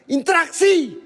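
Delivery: demanding shout. Real, not acted. Demanding spirits show themselves.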